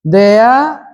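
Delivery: "there" stretched out with a rise; English example word. Shown as a demonstration. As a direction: sound out "there" for the class. (mencontohkan "there" bahasa Inggris)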